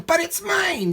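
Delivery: very high, loud shriek of protest; jealous scream. A narrator's reading. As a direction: scream to claim it back. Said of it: "screamed the bird".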